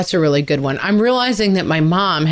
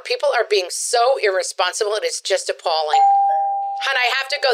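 lightly voiced chatty neighborhood mom; breezy mom who is proud of the nephew; gossipy suburban mom; 7 semitones higher than usual. Sketch mom wrapping up call.

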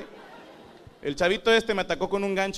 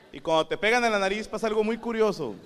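usual speaking voice

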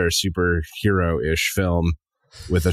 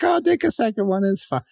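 generous neighbor voice; 13 semitones higher than usual. Retold or imitated. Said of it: "someone who's like".

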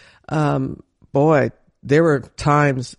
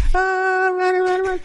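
put-on excited kid; 12 semitones higher than usual. Playful kid running.

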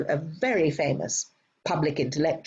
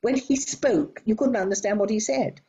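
ordinary talk, nothing marked

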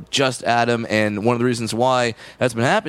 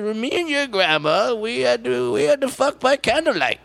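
high trembling grandpa voice; trembling old man voice; 8 semitones higher than usual. Acted. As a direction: quaver like an old grandpa telling a story. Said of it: "your old man voice".

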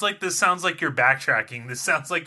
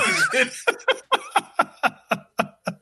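high laugh. Real, not acted. Reacts to own voice joke.

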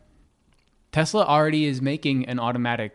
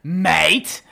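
loud, raised mock-British exclamation; British accent; 5 semitones higher, 6 dB louder than usual. Shown as a demonstration. Giving listeners a tip, loud mock-British accent. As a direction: punch it out as a bad British accent. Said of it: "and then you say".

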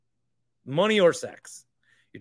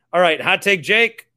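loud hype intro; 4 semitones higher, 9 dB louder than usual. Real, not acted. Announcing the next guest.

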